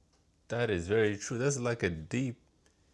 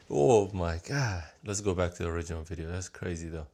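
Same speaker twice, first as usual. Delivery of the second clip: ordinary talk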